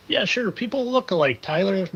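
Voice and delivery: ordinary delivery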